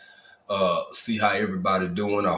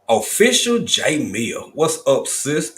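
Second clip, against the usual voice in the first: higher and louder, slow name call; hyped greeting; 5 semitones higher, 6 dB louder, slower than usual. Real, not acted. Host hyping a viewer's arrival, loud and high. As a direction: announce the viewer like a hype man.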